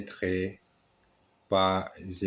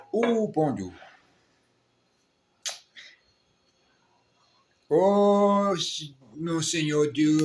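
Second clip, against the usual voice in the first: high startled cry of dismay; real alarm at the spilled coffee; shocked dismay; 8 semitones higher than usual. Real, not acted. Spilled coffee mid-lesson.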